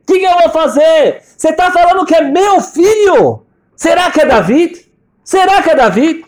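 very high, loud, drawn-out outcry; anguished shouted protest. Retold or imitated. Father Shai protesting to the prophet about another son, high anguished shouting. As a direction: cry out in disbelief, pitch right at the top.

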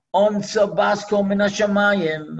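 ordinary talk

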